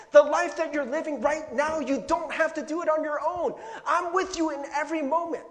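loud, high-pitched, ringing promise; impassioned reassurance. Acted. Preacher voices Jesus promising to carry burdens, loud impassioned reassurance. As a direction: preach it out with full conviction.

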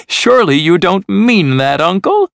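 high, disbelieving plea; protesting nephew. A narrator's reading. Nephew doubting his uncle means it, high and protesting.

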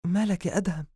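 high-pitched concerned question; worried heroine. Acted. Habiba asks ailing Adham what is wrong, worried. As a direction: ask anxiously after a sick man.

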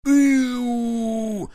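high shout of pain; pained yelp. Acted. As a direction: cry out when shot. Wounded man cries out.

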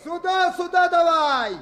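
calling out. Acted. Raftsmen calling to boy from water.

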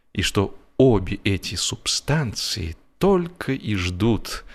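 usual speaking voice

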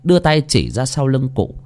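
ordinary delivery, nothing marked

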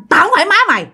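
menacing ghost woman voice; fierce old woman. Retold or imitated. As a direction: bite it off fast like an angry stranger. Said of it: "cái giọng bà rất là hung dữ, nói rất là nhanh".

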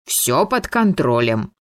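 usual speaking voice

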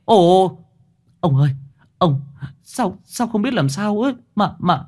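put-on high, panicked stammering old official; frightened funeral official stammering that the coffin is too heavy; terrified. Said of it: "hãi hùng quay lại".

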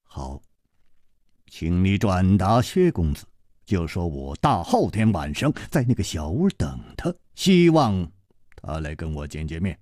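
low, soft, measured request; 楚留香 asking calmly. Acted. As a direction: ask quietly and steadily. 楚留香 gives a message. (楚留香低沉平静地请求)

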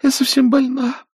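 high, feeble fussy aunt; a fluttery invalid begging off; sickly plea. A narrator's reading. Aunt Pittypat begs the girls to apologize for her.

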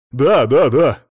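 loud, emphatic yeses; eager agreement. A narrator's reading. Husband yielding to wife's demands, eager. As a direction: agree loudly and hastily.

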